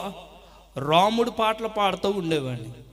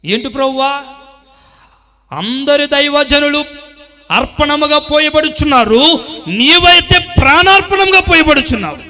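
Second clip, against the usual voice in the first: loud, higher preacher's cry; preaching at full force; 9 semitones higher, 14 dB louder than usual. Acted. Preacher thundering to the congregation, full force. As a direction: drive each line home to the church.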